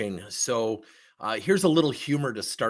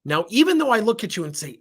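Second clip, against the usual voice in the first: normal talking voice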